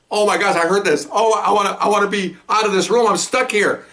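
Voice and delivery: high, agitated dread; retold panic. Overhearing officer stuck in the room, high mock panic. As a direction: fret in a high voice, desperate to escape. Acts out panic at being trapped.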